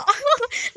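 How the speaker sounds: bright little laugh; short laugh. Real, not acted. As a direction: laugh lightly to yourself. Laughing at herself.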